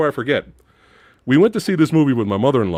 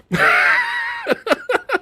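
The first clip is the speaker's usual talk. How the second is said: high squealing laugh. Real, not acted. Bursts out at a plot hole.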